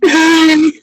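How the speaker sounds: a loud, sobbing laugh on the word Nothing; crying laugh. Real, not acted. Co-host echoing the teary reply, loud laughing cry. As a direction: laugh so hard it sounds like crying.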